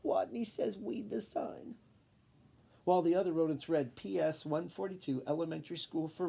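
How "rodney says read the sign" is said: higher, slow little squeak. Acted. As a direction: squeak it out shyly. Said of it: "and he squeaked".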